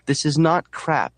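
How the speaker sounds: high, slow, emphatic protest; indignant retort. A narrator's reading. Marla defending the contents of her package, slow and indignant. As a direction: correct them indignantly, drawing it out.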